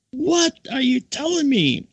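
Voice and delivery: very high, slow astonishment; retold disbelief. Speaker stunned by the nun's secret, high drawn-out disbelief. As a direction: wail it in mock shock.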